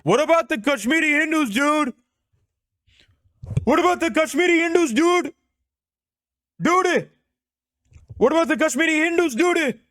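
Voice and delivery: very high, strained mock-Indian streamer yell; Indian xQc. Streamer mocking a debater who pulls up, Indian xQc impression. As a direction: pitch it way up, hammering out each burst. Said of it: "Why did I just do Indian X Q C?".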